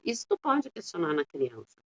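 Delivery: ordinary talk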